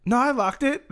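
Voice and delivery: very high mock-British protest; mock British accent. Husband looking out the window, high mock British accent. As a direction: repeat the husband's claim in squeaky put-on British.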